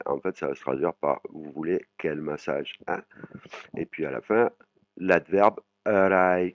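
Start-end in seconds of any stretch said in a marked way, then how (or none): 5.0-6.5 slow, drawn-out arai; Thai word demo. Shown as a demonstration. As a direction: stretch out arai slowly. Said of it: "qui veut dire quel". (arai prononcé lentement et étiré)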